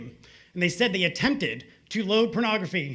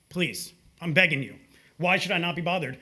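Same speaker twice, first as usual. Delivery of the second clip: normal talking voice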